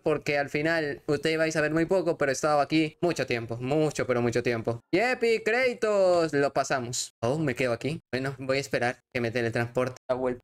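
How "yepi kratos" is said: high, drawn-out hooray; celebratory cheer. Real, not acted. Player celebrates finishing the game, celebratory cheer. Celebrates as end credits appear.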